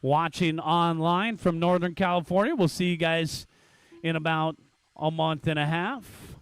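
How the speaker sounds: usual speaking voice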